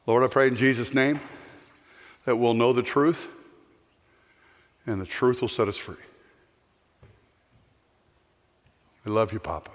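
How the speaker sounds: soft low prayer voice. Real, not acted. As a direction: pray quietly and reverently. Closing prayer.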